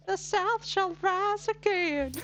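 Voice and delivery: high, loud, drawn-out Southern belle proclamation; Southern accent. Retold or imitated. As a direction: declaim it slowly in a big Southern accent. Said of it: "a wet, uh, Southern accent".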